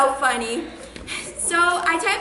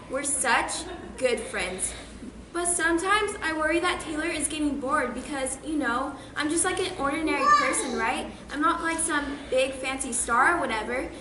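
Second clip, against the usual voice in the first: normal talking voice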